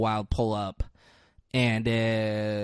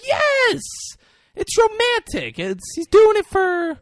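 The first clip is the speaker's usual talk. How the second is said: high, loud mock protest; hammed-up emphatic defence; acted heated insistence; 4 dB louder, slower than usual. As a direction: argue back in a raised, insistent voice. Friends argue back at Susan.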